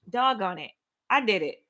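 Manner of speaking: ordinary delivery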